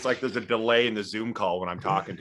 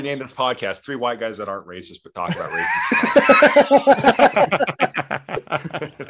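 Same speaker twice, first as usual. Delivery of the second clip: laughing shout; 4 semitones higher, 10 dB louder than usual. Real, not acted. Laughing joke title proposal.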